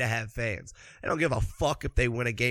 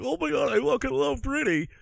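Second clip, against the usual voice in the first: much higher, giddy fan squeal; mock a starstruck fan; mock gushing fan; 10 semitones higher than usual.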